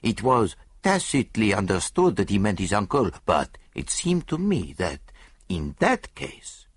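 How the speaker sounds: put-on foreign-accented detective, assured and deliberate; measured accented exposition; Poirot, explaining the case. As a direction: lecture with relish as the great detective.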